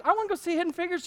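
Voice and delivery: high, quick mock enthusiasm; fast fake eager. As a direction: rush it out pretending to want it. Fake eager reply to wife.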